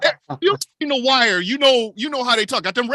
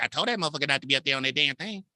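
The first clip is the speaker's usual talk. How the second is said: lower, fast scolding mom; 7 semitones lower, 5 dB quieter, much faster than usual. Retold or imitated. Mom scolding about the neighbor on the pole, low and fast. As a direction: grumble it like an annoyed mother. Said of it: "She's like".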